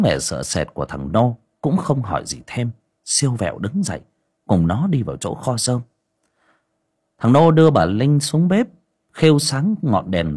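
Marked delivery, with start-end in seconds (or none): none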